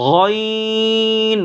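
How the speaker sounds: long, full, intoned "গইন"; thick long letter. Shown as a demonstration. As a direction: stretch "গইন" as a heavy ghayiiiin. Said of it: "মোটা".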